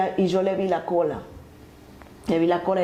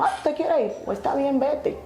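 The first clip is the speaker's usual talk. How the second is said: higher-pitched retold send-off; imitating dismissive parents; 6 semitones higher than usual. Parents let daughter leave home, dismissive in retelling. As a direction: lightly mimic parents giving up, pitched up. Voices parents letting her go.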